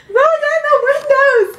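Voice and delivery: very high loud yell cracking into laughter; laugh-cry panicked scream. Retold or imitated. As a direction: scream it while nearly crying with laughter. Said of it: "As I screamed".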